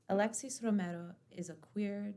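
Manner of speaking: ordinary delivery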